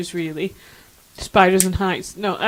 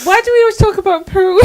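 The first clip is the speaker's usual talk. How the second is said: laughing shout; 10 semitones higher, 7 dB louder than usual. Real, not acted. Laughing outburst at the topic.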